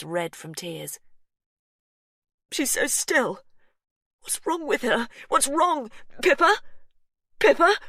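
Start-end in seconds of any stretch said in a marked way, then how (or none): 2.5-7.8 high, loud rising calls; husband calling to his still wife, high and loud; desperate calling. A narrator's reading. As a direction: call her name in panic. Hugh panicking over wife.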